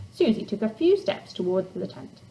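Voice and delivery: ordinary delivery, nothing marked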